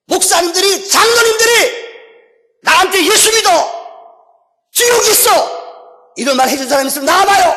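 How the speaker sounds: retold screaming. Man at judgment seat accusing pastors and elders, high screamed challenge. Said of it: "막 괴성을 지르더래요".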